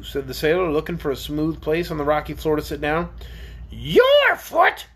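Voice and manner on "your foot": loud, very high creature squawk; crying out. A narrator's reading. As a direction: squawk it indignantly as the orc. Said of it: "cried the orc".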